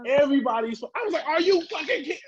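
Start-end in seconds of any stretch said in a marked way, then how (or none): 1.3-2.2 much higher, retold outraged outburst; heated disbelief. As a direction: act out the old fury in disbelief. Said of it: "I was heated".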